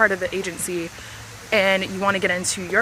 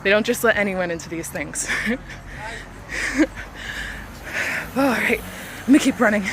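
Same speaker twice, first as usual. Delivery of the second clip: out of breath; 2 semitones higher, 4 dB louder than usual. Real, not acted. Talking while running.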